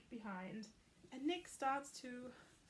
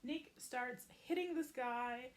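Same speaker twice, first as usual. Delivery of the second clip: usual speaking voice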